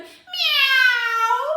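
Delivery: high, loud, stretched-out cat cry; super loud meow. Acted. Storyteller as cat scared by the door, super loud meow. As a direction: yowl it like a startled cat. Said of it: "Super loud".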